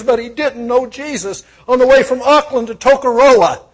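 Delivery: loud, high revival preaching; fiery preacher in full flow; preaching at full force. Acted. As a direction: proclaim it to the whole hall.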